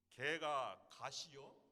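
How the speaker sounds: slow, drawn-out Chungcheong accent; Chungcheong dialect. Shown as a demonstration. Pastor showing the congregation Chungcheong speech, slow in a Chungcheong accent. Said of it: "충청도 말로 얘기하면".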